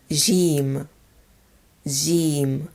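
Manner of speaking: Jim begun with a buzzing zh; soft J sound. Shown as a demonstration. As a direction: say Jim with the J of jellyfish, buzzed. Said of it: "This is the usual Z sound".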